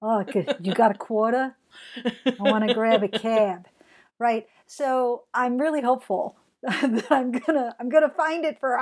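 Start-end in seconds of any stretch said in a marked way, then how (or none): 0.0-3.7 lightly done New York voice, quarter as kwawtuh; a quick New Yorker asking for change; New York accent. Retold or imitated. Showing the accent-class phrase, light New York accent. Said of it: "for New York".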